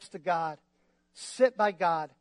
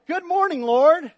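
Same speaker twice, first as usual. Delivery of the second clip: higher and louder, bright and eager; joyful morning person greeting the Lord; cheery greeting; 6 semitones higher, 8 dB louder than usual. Retold or imitated. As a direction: sing out a happy hello to God.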